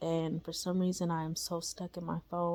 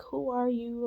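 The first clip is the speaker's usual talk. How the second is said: freaking out; 6 semitones higher than usual. Retold or imitated. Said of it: "I'm freaking out".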